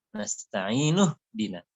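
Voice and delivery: "Nasta'inu dina" slowly, stretched i then -nu into "dina"; joined reading. Shown as a demonstration. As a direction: carry "Nasta'inu" over into "dina". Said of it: "kalau kita sambung". ("Nasta'inu dina" disambung, akhiran u jelas)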